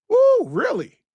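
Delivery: high loud "Woo, really?"; astonished. Real, not acted. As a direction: shoot up in disbelief. Astonished at imagined help.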